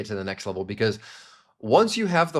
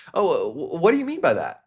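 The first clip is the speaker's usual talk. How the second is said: higher-pitched, genuinely interested question; curious; 6 semitones higher than usual. Shown as a demonstration. Salesperson probing a prospect during discovery, curious. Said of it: "Number two, curious".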